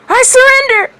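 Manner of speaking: very high, loud sudden scream. A narrator's reading. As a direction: scream it out in panic. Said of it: "he screamed suddenly".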